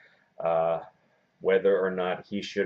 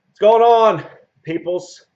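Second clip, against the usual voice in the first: loud high show intro; hype shout; 14 semitones higher, 14 dB louder, slower than usual. Real, not acted. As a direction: blast out the welcome.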